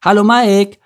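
high, loud called greeting; calling from afar. A narrator's reading. Merle greeting Mike from afar, calling out. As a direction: sing it out across a distance. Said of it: "rief sie schon von weitem".

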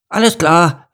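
put-on high, loud kid shout; eager call. A narrator's reading. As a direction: call back brightly as an eager boy. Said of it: "rief Lars".

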